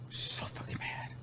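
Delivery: barely voiced, seething whisper; angry whisper. Real, not acted. Worn out from venting about the show, whispering angrily. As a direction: slowly whisper your anger to yourself.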